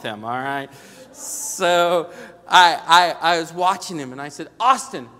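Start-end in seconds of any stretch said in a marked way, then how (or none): 4.6-5.1 raised, higher voice snapping at son; sharp call-out. Retold or imitated. Father calls out to his son in the car, sharp and raised. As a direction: demand an answer from the back seat.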